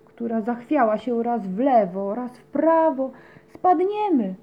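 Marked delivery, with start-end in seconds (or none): 3.5-4.3 scared little cat shrieks "we'll fall"; frightened cry. Acted. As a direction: cry out as the pot tips over. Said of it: "krzyknęła Łaskotka".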